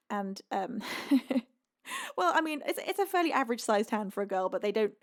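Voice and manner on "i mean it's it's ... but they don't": ordinary delivery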